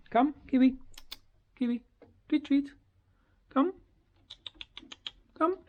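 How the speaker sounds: high, eager calling to the bird; coaxing call. Real, not acted. Owner calls her bird for a treat, high and eager.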